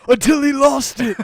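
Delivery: loud, high dramatic announcer line; hammed-up shouted narration; dramatic scream. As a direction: belt it out like an over-the-top intro. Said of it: "and he's screaming".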